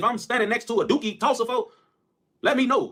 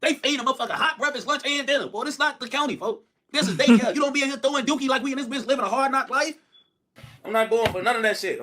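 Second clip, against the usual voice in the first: usual speaking voice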